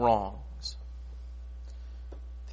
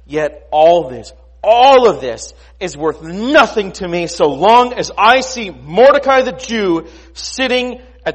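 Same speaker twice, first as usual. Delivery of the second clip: high, loud, emphatic outburst; exasperated Haman; 8 semitones higher, 13 dB louder than usual. Acted. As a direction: rage as a man who can't let it go. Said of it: "he says".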